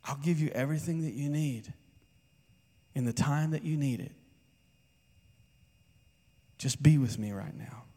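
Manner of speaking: a lightly voiced God calming his child; gentle voice of God. God's promise continues.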